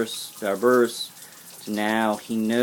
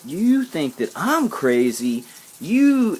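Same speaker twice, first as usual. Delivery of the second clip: angry yell; 7 semitones higher, 3 dB louder, slower than usual. Retold or imitated. Retelling yelling at the stalker, angry yell. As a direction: voice shooting up into a high angry yell. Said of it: "that's why I was yelling at him".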